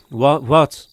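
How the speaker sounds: loud, alarmed call into the dark; startled calling out. Acted. Man waking from a nightmare calls out, startled and loud. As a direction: jolt awake and shout who's there.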